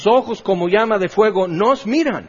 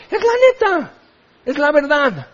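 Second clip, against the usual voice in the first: much higher, insisting it's the truth; high insistent reply; 8 semitones higher than usual. Acted. Pastor answering a protesting congregant, high insistent voice. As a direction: squeak it out as the plain truth.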